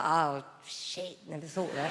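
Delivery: low, soft muttered surprise; muttered realisation. Retold or imitated. Mum realising her son's name is a virtue, low mutter. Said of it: "I was like".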